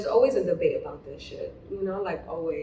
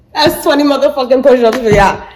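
loud proud brag, pitched up; triumphant shout; 5 semitones higher, 16 dB louder than usual. Real, not acted. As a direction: shout it like a victory. Finished push-ups, bragging.

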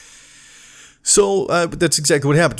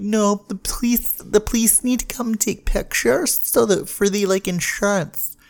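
much higher, slow, put-on whiny voice; mocking whiny driver; 8 semitones higher than usual. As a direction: mimic a dim driver defending himself. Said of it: "And they're like".

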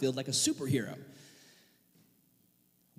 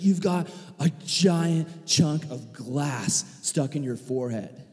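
stunned cousin; 3 semitones lower, slower than usual. Retold or imitated. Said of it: "He's like".

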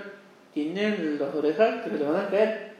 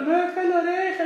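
high mock sob, much higher; imitated crying; 11 semitones higher than usual. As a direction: whine it like a crybaby, hammed up. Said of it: "Y estaba llorando". (imitando llanto agudo, exagerado)

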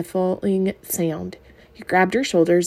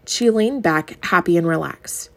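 plain reading voice

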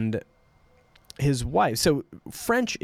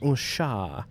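put-on French accent, halting; 4 dB quieter, much slower than usual. Shown as a demonstration. Showing listeners the accent learners fall into, French accent. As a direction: try out French phrases in a French accent. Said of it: "You have this accent".

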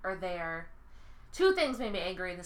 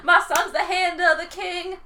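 gloating villain; 8 semitones higher, 9 dB louder than usual. Retold or imitated. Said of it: "Tywin Lannister was like".

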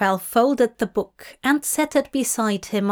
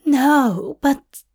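higher, hesitant protest; mildly sulky, higher voice; pouting; 4 semitones higher, 4 dB louder than usual. Acted. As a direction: object with a small pout. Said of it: "Aziraphale pouted".

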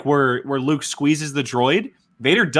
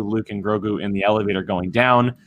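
ordinary delivery

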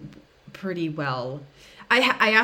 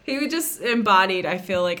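usual speaking voice

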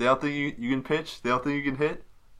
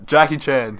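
louder, higher sudden exclamation; excited exclamation; 5 semitones higher, 8 dB louder, slower than usual. Real, not acted. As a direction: burst out with the name. Suddenly seizes on suggested answer.

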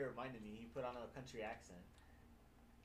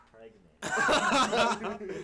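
laughing; 10 semitones higher, 18 dB louder than usual. Real, not acted. Mocking a photo, laughing hard.

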